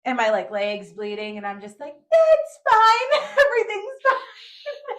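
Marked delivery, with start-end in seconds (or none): 2.1-5.0 high loud teary laugh, slow; laughing-crying denial. Retold or imitated. Bleeding after the fall, high teary laughing denial. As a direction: insist it's fine through laughing tears. Bleeding legs, forced calm.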